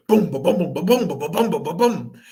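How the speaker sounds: rhythmic booming drumbeats; loud drum imitation. A narrator's reading. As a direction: boom it out like a big drum. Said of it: "started beating it loudly".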